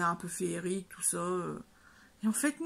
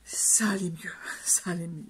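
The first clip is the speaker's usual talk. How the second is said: whispered relief; 2 semitones lower than usual. Real, not acted. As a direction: whisper it with a little laugh. Relief after switching films.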